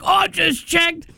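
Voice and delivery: put-on high excited kid, nearly shouting; high panicked kid voice. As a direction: play a frantic kid on the phone. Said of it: "the kid's like".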